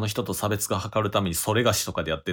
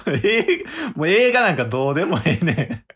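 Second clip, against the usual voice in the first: laughing; 8 semitones higher, 5 dB louder than usual. Real, not acted. Laughing, telling friend to hurry.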